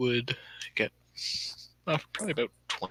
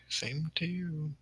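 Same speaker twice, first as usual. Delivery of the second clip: lilting singsong reply; sing-songy; 6 semitones higher, 7 dB quieter, much slower than usual. Acted. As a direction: sing it out slowly, teasing. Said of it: "in a sing-songy voice say".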